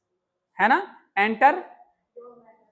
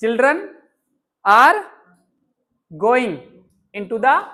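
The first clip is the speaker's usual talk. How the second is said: put-on "Children are going into the" said word by word, each one stressed and louder; "are" hit especially hard, like a dictation; model sentence; 6 dB louder, much slower than usual. Shown as a demonstration. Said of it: "teacher models sentence". ("Children are going into the" शब्द-शब्द जोर देकर)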